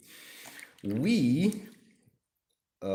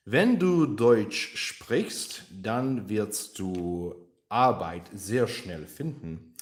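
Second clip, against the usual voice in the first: exaggerated German accent; 2 semitones higher, 6 dB louder, much slower than usual. Shown as a demonstration. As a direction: ham up a thick German accent, dragging the words. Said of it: "this is my exaggerated German accent".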